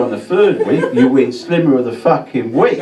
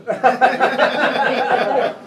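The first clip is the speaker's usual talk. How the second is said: laugh; 11 semitones higher than usual. Real, not acted. Comedian laughs at own joke.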